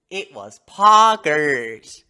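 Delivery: drawn-out hype shout; excited. Real, not acted. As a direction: stretch it out, loud and thrilled.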